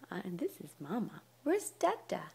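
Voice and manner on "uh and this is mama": soft whispered introduction. Real, not acted. Mother whispering to her baby about herself, soft whisper.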